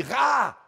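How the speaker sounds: Ra shouted, high and loud; Toulouse Ra. Shown as a demonstration. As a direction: call out Ra as if pointing far away. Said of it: "on dit". (Ra crié comme pour montrer au loin)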